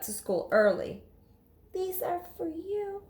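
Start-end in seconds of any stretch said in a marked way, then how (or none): 1.7-3.0 put-on shy girl. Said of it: "Lily said".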